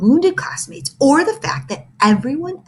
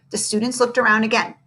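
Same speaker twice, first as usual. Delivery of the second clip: ordinary delivery, nothing marked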